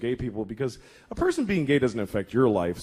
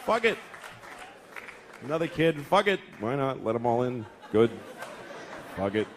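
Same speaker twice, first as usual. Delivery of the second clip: careless, shrugging resignation; whatever, let them all in; shrugging fuck it; 4 semitones lower, 3 dB quieter than usual. Retold or imitated. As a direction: toss it off like nothing matters. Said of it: "That's really the attitude".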